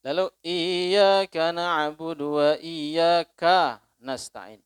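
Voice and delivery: "iyyaka na'budu wa iyyaka" drawn out slowly, the kaf held apart from the next sound; separated kaf recitation. Shown as a demonstration. Preacher demonstrates Sufi recitation, kaf drawn out slowly. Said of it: "mereka memisah kafnya, kadang dipanjangin".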